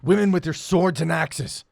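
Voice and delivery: loud, high jeering taunt from a cage; jeering shout. Acted. Caged prisoner taunting the brigands, loud jeering shout. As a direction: bellow insults at the brigands.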